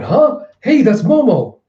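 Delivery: high, loud startled call; surprised recognition. Retold or imitated. Luffy spotting captured Momo, high and loud. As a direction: spot a friend and call out. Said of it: "you have Luffy going".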